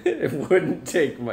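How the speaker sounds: amused, high laughing reply. Real, not acted. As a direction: say it through a real laugh, drawn out. Laughs while echoing wife's joke.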